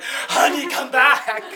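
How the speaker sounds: high, loud mock plea; hammed-up call to a fleeing partner; calling after someone. Man calling after fleeing woman.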